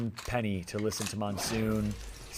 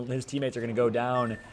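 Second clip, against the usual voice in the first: ordinary talk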